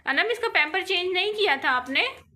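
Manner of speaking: mother scolding daughter about the unchanged diaper, angry. Real, not acted. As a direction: tell her off sharply.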